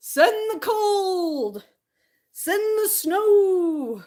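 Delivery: high, drawn-out, slow grand summons; dramatic invocation. Pretend. Dressed in scarf and hat, hammily summoning the snow. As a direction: call on winter like a theatrical queen.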